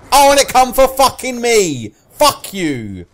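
loud, high-pitched outrage; furious protest; exasperated shout. Real, not acted. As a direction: yell it in aggrieved disbelief. Killed as predicted.

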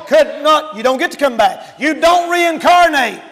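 high, emphatic sermon delivery; forceful preaching. Real, not acted. Preacher warning there is no coming back, preaching forcefully. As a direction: drive the warning home loudly.

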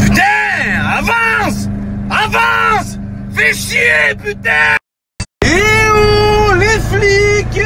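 shouting, very high and loud. Real, not acted. Drunk yelling in car.

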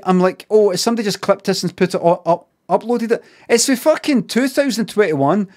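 ordinary talk, nothing marked

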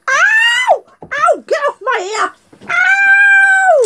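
high yelps of pretend pain; mock pain. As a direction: cry out like it bit you. Crocodile bites again.

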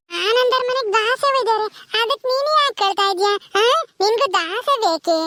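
put-on high-pitched little kid voice; well-meaning confused child. Child mishears the phrase and consoles aunty, childlike. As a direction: console her like a muddled small child.

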